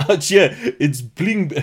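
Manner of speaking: put-on high South African accent breaking into laughter; mock South African, barely getting it out; Leonardo DiCaprio. Host laughing through DiCaprio's bar line, South African accent. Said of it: "and he says to her".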